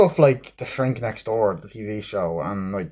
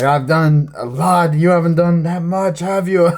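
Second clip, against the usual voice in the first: smug taunting; 6 semitones higher, 5 dB louder than usual. Retold or imitated. Mocking the villain's boast.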